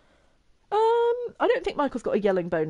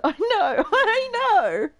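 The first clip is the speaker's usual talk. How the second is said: loud laughing words, rising higher; 11 semitones higher, 7 dB louder, much slower than usual. Real, not acted. Player laughing at her sim in mascot costume, laughing loudly. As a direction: giggle the words out.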